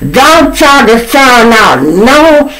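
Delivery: slow, loud, very high mocking imitation; going hard. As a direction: mimic her drawn-out shrieked warning. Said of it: "she kept repeating it".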